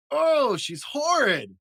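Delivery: very high, slow, gasping delight. A narrator's reading. Dad voicing monster parents gasping in delight. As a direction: gasp with joy at the ugly baby. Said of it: "gasped Mister and Missus Monster happily".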